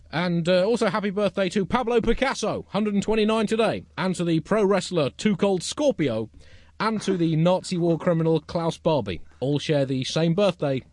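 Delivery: high, loud, emphatic roll call; announcer. Acted. Host reading out famous birthdays, mock-grand announcer delivery. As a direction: proclaim each name to the crowd, slowing at the end.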